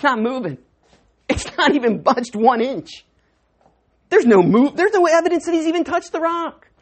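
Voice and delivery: loud, high, building to a shout; rising preacher shout. Acted. Preacher shouting to the congregation, loud and high.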